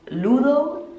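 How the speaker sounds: Ludo in Spanish, slow rising LOO-tho; Spanish accent. Shown as a demonstration. Telling viewers why it sounds weird, Spanish accent. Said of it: "sound like in Spanish".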